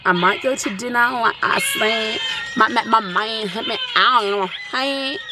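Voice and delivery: mumbled words as if no teeth; toothless talk. Pretend. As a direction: talk like you have no teeth. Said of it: "I'm talking like I got no teeth".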